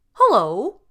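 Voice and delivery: high, loud cheery greeting; bright storybook lion voice; friendly lion hello. A narrator's reading. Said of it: "said Leonard".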